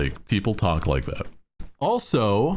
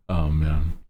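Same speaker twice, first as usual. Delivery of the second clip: normal talking voice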